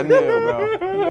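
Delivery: drawn out through laughter; laughing. Real, not acted. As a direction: laugh it out slowly. Laughing at own slip.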